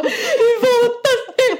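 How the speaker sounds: high, slow, wailing laughing cry; near tears. Retold or imitated. Girlfriend wailing at boyfriend in public, slow teary cry. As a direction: drag it out in a teary wail. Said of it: "började jag nästan gråta".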